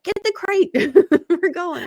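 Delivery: decide to rescue the kitten, giggling; laughing determination. Retold or imitated. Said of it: "I was like".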